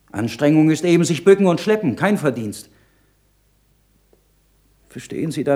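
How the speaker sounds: put-on a young monk crying out for his poor parents; impassioned outcry. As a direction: let the anguish build into a cry. Monk's second impassioned climax.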